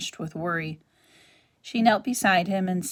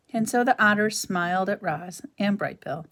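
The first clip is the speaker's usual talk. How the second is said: usual speaking voice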